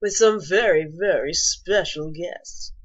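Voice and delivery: put-on lounge host delivery, slowing at the end; slow down smoothly like a lounge act; cheesy lounge announcer. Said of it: "I put on my lounge voice for that".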